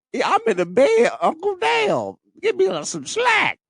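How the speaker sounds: high-pitched, exasperated plea rising to a yell; whiny protest. Retold or imitated. Nephew in hospital bed pleading with uncle, high exasperated whine.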